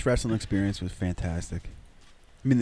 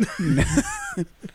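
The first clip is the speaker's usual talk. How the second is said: high-pitched laugh. Real, not acted. Laughing at own story.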